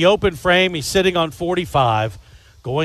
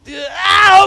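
excited shout; 7 dB louder than usual. Real, not acted. Shout as the pin falls.

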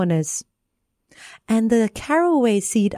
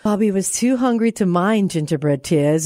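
usual speaking voice

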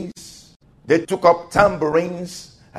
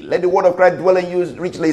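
brisk, run-through recitation; quick scripture repeat; faster than usual. Acted. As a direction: rattle the verse off in one fast run.

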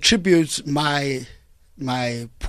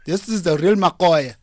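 ordinary talk, nothing marked

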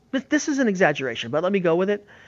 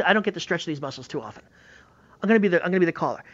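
ordinary talk, nothing marked

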